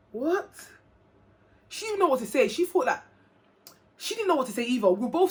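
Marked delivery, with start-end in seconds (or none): 0.1-0.5 retold shock. Friend reacting to the pregnancy news.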